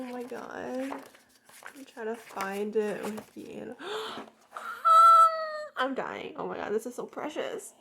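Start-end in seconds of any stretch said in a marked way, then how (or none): 4.5-5.7 huge, very high gasp of delight; loud high gasp. Real, not acted. Finds the animal photos.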